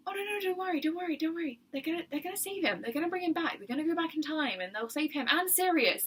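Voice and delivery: hammed-up soothing, as if calming a sobbing eight-year-old; soothing teen counsellor. Counsellor soothing crying child.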